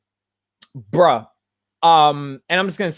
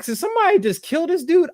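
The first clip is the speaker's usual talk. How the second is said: high-pitched, quick incredulous question; imagined onlooker; 11 semitones higher, faster than usual. Acted. Onlookers react to a mouthy slave, high incredulous voice. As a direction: voice a startled onlooker, pitched well up.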